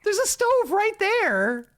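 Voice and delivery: slow, loud, high-pitched protest; incredulous squeal. Real, not acted. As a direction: squeal each word in disbelief. Incredulous at the character's behaviour.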